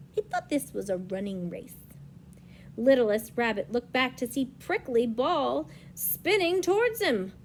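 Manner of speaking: usual speaking voice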